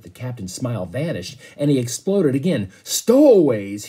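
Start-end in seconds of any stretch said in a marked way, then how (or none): 2.8-3.9 slow, louder furious roar. Acted. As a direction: explode with rage at the news. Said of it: "he roared".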